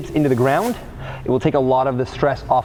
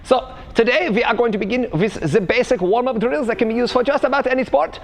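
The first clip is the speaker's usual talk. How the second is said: hammed-up German fitness coach explaining; the v for w, the j sounds, stern but cheery; fake German accent; 8 semitones higher than usual. Coach explaining warm-up theory to viewers, fake German accent.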